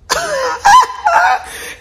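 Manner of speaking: high burst of laughter. Real, not acted. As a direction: laugh at your own joke. Laugh after pun.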